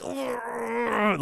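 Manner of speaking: high strained groan; a choked-back high ugh; mock groan. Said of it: "It's like".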